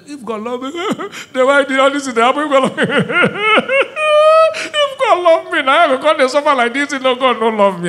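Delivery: high, loud, mock-crying whine with laughter; mocking self-pity. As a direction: ham up a whining, doubting believer. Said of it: "Some of you sit and just like".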